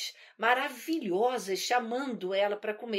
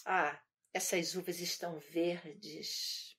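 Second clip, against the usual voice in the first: lower, softer, slow sniff of disdain; disdainful fox; 6 semitones lower, 7 dB quieter, slower than usual. Retold or imitated. Fox dismisses the grapes it cannot reach, low and slow. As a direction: brush it off slowly like a sour fox. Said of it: "E fala assim".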